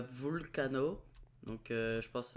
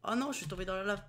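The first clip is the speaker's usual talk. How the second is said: higher, louder panicked yelps; panicking gamer; 8 semitones higher, 8 dB louder than usual. Real, not acted. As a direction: freak out as you burn in the lava. Character burning in lava.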